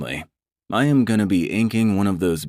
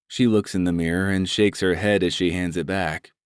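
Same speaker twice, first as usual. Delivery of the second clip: ordinary delivery, nothing marked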